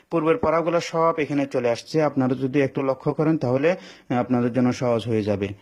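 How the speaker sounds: ordinary talk, nothing marked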